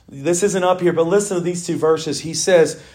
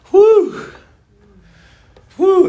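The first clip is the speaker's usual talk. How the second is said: joyful whoop; 12 semitones higher, 5 dB louder than usual. Real, not acted. Preacher cheering out.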